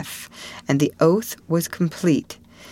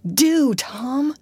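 high, urgent pleading; frightened plea; 9 semitones higher than usual. Acted. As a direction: beg your friend to do it. Huck pleads with frightened Tom.

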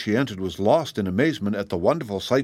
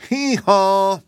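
high, harsh hee-haw; startling high donkey call, louder than speech; loud mule bray; 10 semitones higher, 5 dB louder than usual. A narrator's reading. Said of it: "he uttered a loud".

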